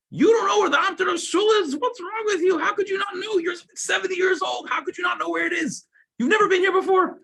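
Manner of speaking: high, loud scolding shout; shouting at an old man in disbelief; incredulous yelling. Retold or imitated. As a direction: berate him like he's a fool. Locals scold the visitor.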